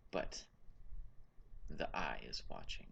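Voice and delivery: low, hushed and ominous; ominous low whisper. Acted. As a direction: murmur it like a dark warning. NPC ominous repeat.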